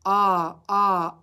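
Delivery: "ah, ah" as a short open vowel; teacher modelling the short o sound. Shown as a demonstration. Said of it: "O makes the sound".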